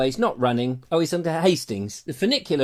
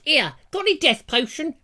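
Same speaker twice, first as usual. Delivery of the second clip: put-on Juliet voice; 9 semitones higher than usual.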